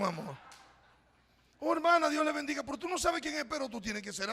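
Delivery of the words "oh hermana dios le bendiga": high, loud, over-sweet hello; mock effusive greeting. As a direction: gush a fake-warm blessing at a stranger. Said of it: "hacemos de hipócrita". (saludo hipócrita, alto y exagerado)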